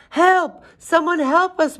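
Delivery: high, loud storybook wail; wailing for help. A narrator's reading. Animals held by the giant beg for rescue, wailing. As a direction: cry out high and loud in mock panic. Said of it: "wailed the animals".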